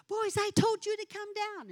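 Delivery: put-on high, sharper call to the boys; mock scolding shout up the stairs; retold yelling. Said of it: "I was screaming at them".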